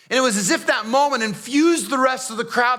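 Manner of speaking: ordinary talk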